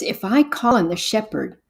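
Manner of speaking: usual speaking voice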